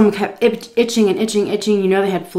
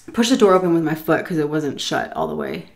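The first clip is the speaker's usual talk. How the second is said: ordinary delivery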